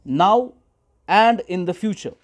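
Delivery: very slow, word by word; slow dictation. Shown as a demonstration. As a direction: dictate it slowly for notes. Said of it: "dictation, slow repeat". (धीमे-धीमे लिखवाना)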